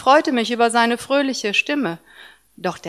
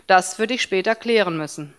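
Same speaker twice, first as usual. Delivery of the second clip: usual speaking voice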